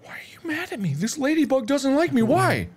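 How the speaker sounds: high squeaky dismay; high-pitched alarm. Real, not acted. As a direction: plead with the bug, alarmed. Upset ladybug attacks.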